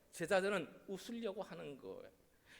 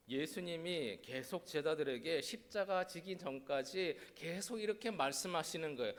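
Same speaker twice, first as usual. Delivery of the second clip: usual speaking voice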